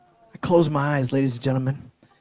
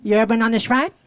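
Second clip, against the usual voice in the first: mumbled voice through braces; kid with braces; 4 semitones higher, much faster than usual. Retold or imitated. Kid in braces asking the comedian, mumbled through metal. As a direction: talk like a mouth full of metal. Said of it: "he looks at me and goes".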